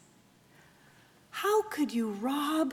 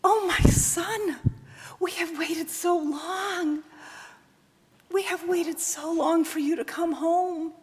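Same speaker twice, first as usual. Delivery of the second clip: high, loud tearful pleading; a son choking back sobs of shame; anguished son; 9 semitones higher, 9 dB louder than usual. Acted. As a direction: break down confessing to his mother. Son's anguished confession.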